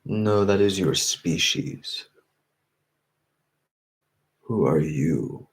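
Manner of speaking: slow, drawn-out challenge in a lightly voiced monk role; measured monk-like questioning, lightly acted. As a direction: lightly play a monk slowly correcting and questioning. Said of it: "as the monk does in his video".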